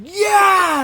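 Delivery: high loud shout of agreement; crowd cheer. Retold or imitated. Everyone answering the yell, loud cheer. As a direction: cheer back like a rally crowd. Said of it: "And everyone will be like".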